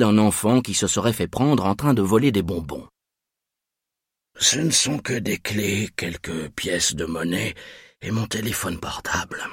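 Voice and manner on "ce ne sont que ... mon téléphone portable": softer murmur, slowing down. A narrator's reading. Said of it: "murmura-t-il".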